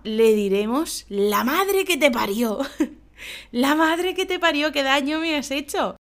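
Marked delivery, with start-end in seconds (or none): 1.1-6.0 yelping in mock pain after a stepped-on foot; pain outburst. Someone stepped on your bare foot, mock pained yelp. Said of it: "alguien nos pisa".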